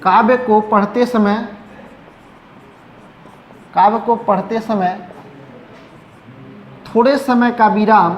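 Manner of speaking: dictation. Acted. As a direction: dictate it phrase by phrase for students to write. Dictating a definition to class. (धीरे-धीरे, रुक-रुककर लिखवाना)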